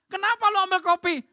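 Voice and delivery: high, loud startled question; acted outburst of suspicion; alarmed husband. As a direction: demand an answer in mock alarm. Acted husband questioning his wife.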